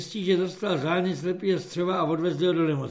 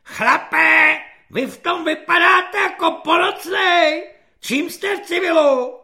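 high, loud barking corporal; shrill shouting corporal; 12 semitones higher, 9 dB louder than usual. Acted. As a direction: bellow at the new recruit. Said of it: "ječivým hlasem svobodníka".

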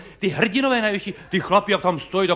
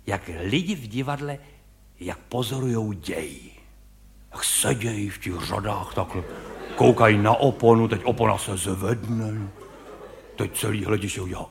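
usual speaking voice

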